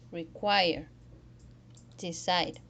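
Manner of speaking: "require", "decide", each stressed on the final syllable; "require", "decide", spaced out, second syllable strong; last-syllable stress. Shown as a demonstration. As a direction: lean on the end of "require" and "decide". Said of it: "Stress the last syllable".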